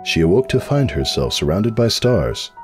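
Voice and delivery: ordinary delivery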